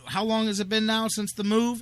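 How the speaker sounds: ordinary talk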